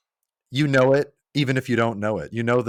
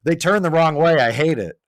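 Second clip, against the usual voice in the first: retold complaint; 6 semitones higher, 6 dB louder than usual. Retells friend's complaint about the game.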